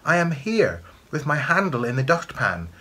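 high little reply voice; high-pitched enchanted object; answering broom. A narrator's reading. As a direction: pipe up in answer. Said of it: "the broom answered".

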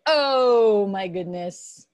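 "Oh" loud and stretched, then "my goodness" falling away; exclaimed long O. Shown as a demonstration. Teacher showing students a phrase with O, drawn-out exclamation. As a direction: draw out "Oh" as a big exclamation. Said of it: "you can say".

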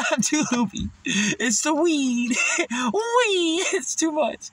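high, slow words through giggles; stoned giggly rambling; laughing while talking. Real, not acted. Laughing about being high.

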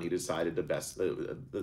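ordinary delivery

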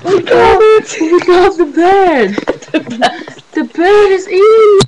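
loud, slow triumphant yell; excited shouting. Real, not acted. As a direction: shout out the win, drawn out. Bird finally caught, triumph.

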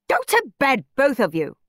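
high, exasperated shriek. A narrator's reading. Aunt Ruby to both boys, shrieking. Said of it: "shrieked Ruby".

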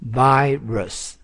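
normal talking voice

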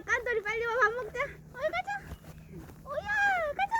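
high calling voice. Real, not acted. Calling her pets to eat.

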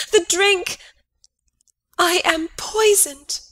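a gasped whisper rising into high, desperate cries; dying gasp. Acted. Queen Gertrude to Hamlet, poisoned, gasping in panic. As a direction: choke out the words as the poison takes hold.

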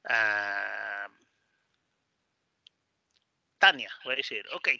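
ordinary talk, nothing marked

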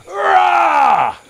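high, loud roaring shout. Shown as a demonstration. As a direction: roar so the neighbours hear. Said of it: "so the neighbours three doors down can hear this".

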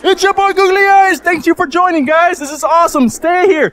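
hyped host. Real, not acted. Streamer thanks viewers for joining, hyped and high. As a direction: hype up the viewers.

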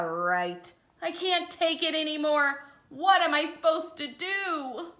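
a fed-up bird losing patience; a touch of a cranky pigeon; frazzled pigeon. Acted. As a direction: pigeon boiling over at the duckling. Reads the Pigeon's part.

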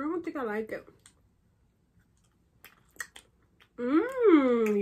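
chewing while talking; muffled by chewy gummy; mouth full of candy. Real, not acted. Seen 1: Woman bites and chews a gummy through about second 4.4.

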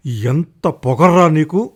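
indignant, drawn-out rebuke; angry retort. Acted. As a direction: scold an insolent servant. Narrator's angry imagined retort.

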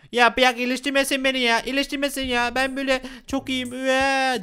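high-pitched mock sulky protest; upset Panda whining. Panda refuses to be cured, high pretend whining. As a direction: whine like a crying patient.

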